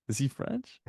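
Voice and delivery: high puzzled mock question; confused questioning. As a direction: ask it half baffled, teasing. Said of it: "I'm like".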